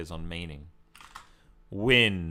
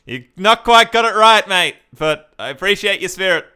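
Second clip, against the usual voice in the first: amused; 6 semitones higher, 9 dB louder than usual. Real, not acted. Addressing the fella fondly.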